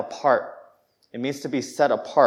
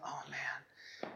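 whispered, acted dismay; deflated whisper; 23 dB quieter than usual. Preacher reacting to brother listing his sins, deflated whisper. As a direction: breathe it out, crestfallen. Acting crushed by brother's criticism.

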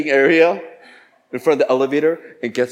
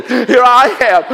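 frantic retold outburst; 10 semitones higher, 10 dB louder, slower than usual. Acting out his frantic run.

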